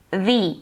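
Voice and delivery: "the" repeated as a clear "thee"; THE before a vowel. Shown as a demonstration. English teacher repeating "the" for learners, pronounced "thee". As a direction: model "the" with long ee. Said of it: "vai ser pronunciado como".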